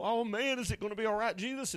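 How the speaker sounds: preacher imagines asking Jesus for help in a storm; mock fearful plea. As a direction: play a scared disciple begging for comfort. Imagined fear in a big storm.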